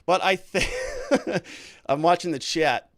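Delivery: slow start breaking into laughter; distracted and cracking up; laughing. Real, not acted. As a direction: talk through a real laugh. Cracks up reading the chat.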